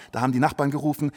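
ordinary delivery

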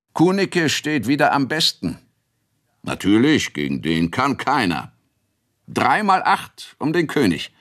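hoch und laut, derbe Bauernrunde beim Kegeln; Kegelbrüder im Wirtsgarten. Acted. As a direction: die Zurufe der Bauern lebhaft hochgezogen. Kegelbrüder rufen durcheinander. (laute, fröhliche Bauernstimmen)

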